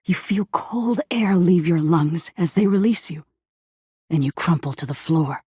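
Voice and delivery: ordinary delivery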